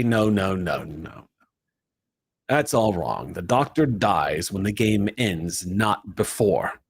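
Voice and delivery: a hint of a cold villain correcting them; lightly voiced villain. The Master scolding the chaos, a hint of menace. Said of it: "the voice once again say".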